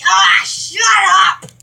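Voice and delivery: slow, loud, drawn-out shut up; mock shout. Kid imitating himself shouting at someone, slow and loud. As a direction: stretch it out as a hammed-up yell. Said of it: "I'll be like".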